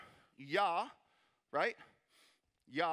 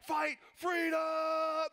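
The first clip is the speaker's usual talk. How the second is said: long, slow, higher rallying shout; Braveheart battle cry; 9 semitones higher, 4 dB louder, much slower than usual. Retold or imitated. Pastor hamming up Wallace's cry to the crowd, a loud drawn-out shout. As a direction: bellow it like Wallace rallying the troops. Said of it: "William Wallace Braveheart theme song".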